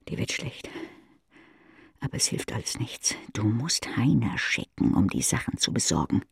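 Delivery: near-whispered, sinking and queasy; hushed dread. A narrator's reading. Narrator hushing the cook's panic, near-whispered dread. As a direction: let the voice drop to a breathless hush.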